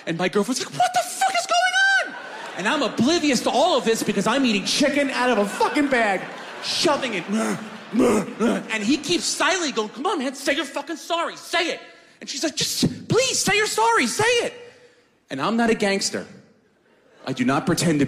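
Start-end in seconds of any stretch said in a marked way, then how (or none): 0.7-2.1 very high shrieked panic; hammed-up frantic girlfriend; panicked girlfriend. 9.9-11.8 higher mock gunman. 12.5-14.5 very high pleading; hammed-up panicked plea; begging girlfriend.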